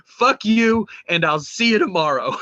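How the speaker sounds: a hammed-up Larry David rant, high and loud on Fuck you; half-laughing mock rage; mock outburst. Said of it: "Larry David saying".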